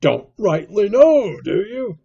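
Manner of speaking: "Don't rightly know" thrown back loud and high; mock his answer, shouting "Don't rightly know"; loud, jeering repetition; scornful echo. A narrator's reading. Silver throws Morgan's answer back.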